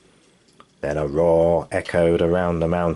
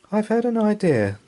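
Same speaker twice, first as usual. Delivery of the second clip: put-on child voice; 13 semitones higher than usual. Son's voice.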